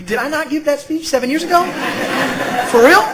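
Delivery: high, fast and laughing; incredulous disbelief. Retold or imitated. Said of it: "To which I'm sure she was thinking".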